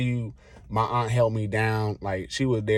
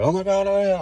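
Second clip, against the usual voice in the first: high, loud mock freakout from coworkers; mock panicked coworkers; 12 semitones higher, 5 dB louder than usual. Coworkers panicking over the hurt manager, high mock squeal.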